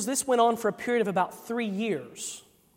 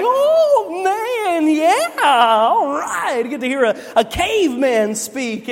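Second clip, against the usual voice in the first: put-on high, loud, drawn-out kid's cheer; hammed-up childish thrill, stretching the words; excited little kid; 7 semitones higher, 7 dB louder, much slower than usual. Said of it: "as a little kid, I was like".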